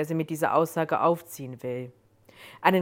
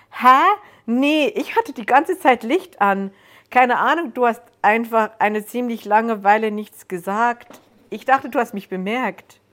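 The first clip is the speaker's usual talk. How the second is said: loud, high, flustered self-defence; taken-aback protest; 5 semitones higher, 8 dB louder than usual. A narrator's reading. Visitor defending themself to frightened Joan, loud and taken aback. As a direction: protest in a raised, surprised voice.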